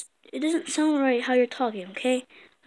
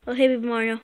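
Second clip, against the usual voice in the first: normal talking voice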